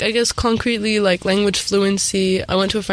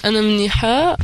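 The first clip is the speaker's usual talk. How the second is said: Levantine Arabic reply, quick and light; 2 semitones higher, much faster than usual. Real, not acted. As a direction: answer in a Levantine Arabic accent. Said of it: "What kind of accent do you have".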